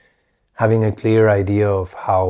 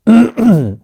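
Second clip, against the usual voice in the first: loud sharp throat clear; 13 semitones higher, 11 dB louder than usual. Real, not acted. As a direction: clear your throat hard. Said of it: "sorry about the, the coughing".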